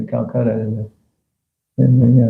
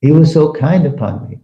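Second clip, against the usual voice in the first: ordinary talk, nothing marked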